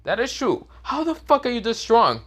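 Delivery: high, amazed mock girl voice; awed girls. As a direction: marvel at his strength. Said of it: "the girls look at Baruto and say".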